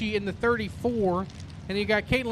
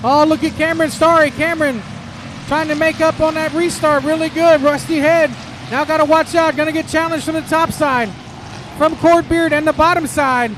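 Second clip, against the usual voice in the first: lively live race call; motorsport commentator; 5 semitones higher, 6 dB louder than usual. Real, not acted. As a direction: call the laps as they unfold.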